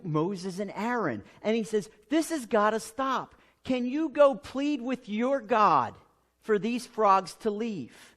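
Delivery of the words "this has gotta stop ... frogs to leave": pleading Pharaoh. Acted.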